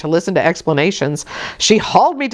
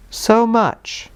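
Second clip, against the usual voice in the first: doubtful; 5 semitones higher than usual. Acted. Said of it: "was the doubtful answer".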